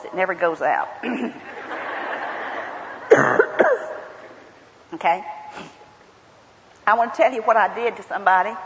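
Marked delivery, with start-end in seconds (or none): 0.9-4.0 cough, then laugh it off. Real, not acted. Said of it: "Don't worry about my voice".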